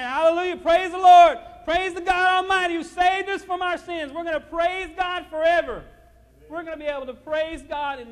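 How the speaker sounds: high, loud hallelujah cry; jubilant shout of praise. Acted. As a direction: shout it out like the saved in heaven.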